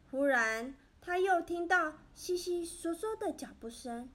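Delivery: plain reading voice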